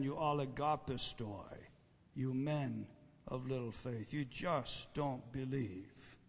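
hushed, sorrowful rebuke; soft rebuke. Acted. Preacher voicing Jesus, quiet disappointed rebuke. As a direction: let it sink low and slow.

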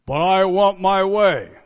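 loud demanding But I want my way; mock selfish spouse, loud. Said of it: "In a marriage".

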